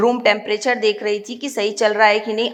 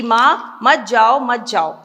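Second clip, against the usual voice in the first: usual speaking voice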